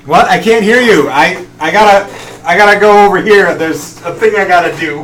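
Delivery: loud and high, feigning bad reception; pretending not to hear. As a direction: shout as if you can't hear them. [name] dodging, pretending not to hear.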